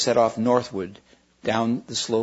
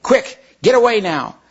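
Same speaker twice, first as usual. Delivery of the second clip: quick and higher, louder; urgent; 10 semitones higher, 7 dB louder than usual. Acted. Polly urges Diggory to flee, urgent and raised. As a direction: hurry him off.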